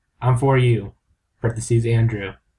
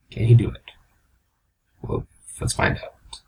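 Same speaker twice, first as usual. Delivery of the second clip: hushed suspense; 3 semitones lower than usual. Acted.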